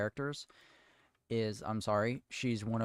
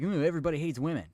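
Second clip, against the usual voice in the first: higher, louder, fast sarcastic whine; mocking whine; 6 semitones higher, 5 dB louder, much faster than usual. Mocking the showrunners' excuse with a fast whiny sneer. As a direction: mimic them as whiny and dismissive. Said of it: "It's like".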